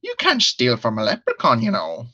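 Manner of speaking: put-on leprechaun voice in a wandering Irish accent; Irish accent slipping toward Australian. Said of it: "It's not a bad impression".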